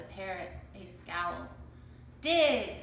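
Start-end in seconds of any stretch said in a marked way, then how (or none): 2.2-2.7 louder, higher pirate command; shouted. A narrator's reading. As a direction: yell the order. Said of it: "shouted Captain Bones".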